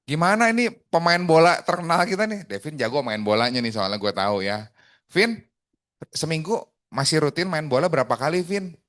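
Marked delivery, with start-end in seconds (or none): 0.0-2.5 high, loud, teasing hail; hearty personal opener. Shown as a demonstration. Coach calling Devin the famous footballer, hearty and upbeat. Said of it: "Misalkan nanya ke Devin". 5.1-8.7 high, keen question to a friend; chummy personal opener. Shown as a demonstration. Coach asking Devin about weekly football, chummy and upbeat. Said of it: "Misalkan nanya ke Devin".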